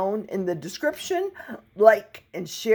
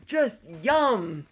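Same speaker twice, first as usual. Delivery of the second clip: ordinary delivery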